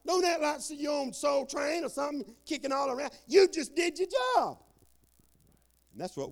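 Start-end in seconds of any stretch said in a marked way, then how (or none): 0.0-4.6 coach shouting. Retold or imitated. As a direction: bark it like a coach on the sideline. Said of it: "And I tell him, I said".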